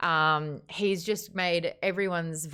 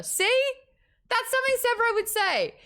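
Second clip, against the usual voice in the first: very high, loud burst of triumph; excited vindication; 5 dB louder than usual. Real, not acted. Host feels proven right about Severo, high excited outburst. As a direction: pounce on the proof in a high squeal.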